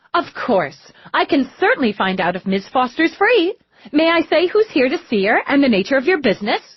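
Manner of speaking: a lightly voiced eager receptionist; perky receptionist. A narrator's reading. Receptionist greeting the police at the counter, chirpy.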